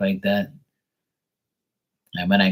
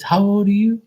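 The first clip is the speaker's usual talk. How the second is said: high, louder auntie question; aunt's voice; 12 semitones higher, 6 dB louder than usual. Retold or imitated. Aunt quizzing her nephew on the couch, high voice. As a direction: ask it like a curious old aunt.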